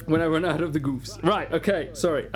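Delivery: ordinary delivery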